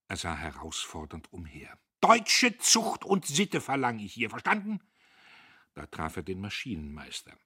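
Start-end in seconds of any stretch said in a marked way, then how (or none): 2.0-4.8 pompous angry command; stern shouted demand. A narrator's reading. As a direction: proclaim the rule to cowed workers. Boss lays down law to workers.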